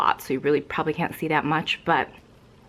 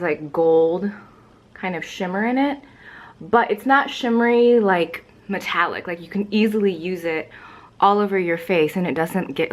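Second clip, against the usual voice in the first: ordinary talk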